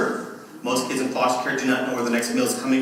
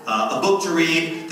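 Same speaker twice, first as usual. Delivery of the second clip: ordinary talk, nothing marked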